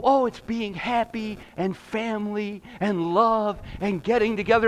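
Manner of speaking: breezy imitation of a Hallmark movie answer; mock movie character. Pastor mocking Hallmark movies' sugary answer, lightly voiced. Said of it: "And then they go".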